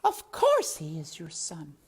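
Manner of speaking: put-on French-accented mother mouse. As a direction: tut him in a French accent. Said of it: "said Antoinette".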